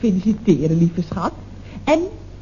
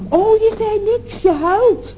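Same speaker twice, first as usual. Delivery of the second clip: dawning realisation; 10 semitones higher, slower than usual. Acted. Realises caller is crying.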